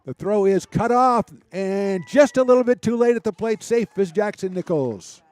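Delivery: loud, high baseball call as the run scores; excited play-by-play. Real, not acted. Live call of scoring play.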